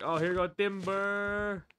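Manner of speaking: high, drawn-out lumberjack call; calling timber. Pretend. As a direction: sing out timber as it falls. Tree falls, calls out.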